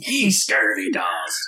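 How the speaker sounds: drawn-out, raspy-whispered pirate insult. Pretend. Player joins in the table's joke, mock pirate. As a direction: stretch it out like a hammy pirate. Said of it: "talk like a pirate".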